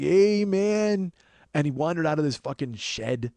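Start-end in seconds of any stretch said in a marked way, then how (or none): 0.0-1.1 higher, louder drawn-out stoner greeting; imitating spaced-out dude. Mimicking the backyard customer greeting the delivery guy, spaced-out dude. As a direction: do the goggly-eyed guy's loud hello. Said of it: "just kinda like".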